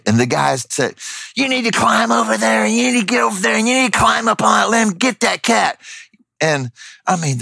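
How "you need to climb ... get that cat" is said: high, fast mock voice giving orders; arguing bystander. Bystander arguing over how to reach the cat, mock high-pitched bossing. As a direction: imitate a pushy man rattling off instructions. Bystander argues back in quarrel.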